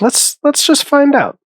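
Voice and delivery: quoted thought jumping up high; eager inner voice. Retold or imitated. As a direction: act out giving in to curiosity, pitched high. Acted own eager thought.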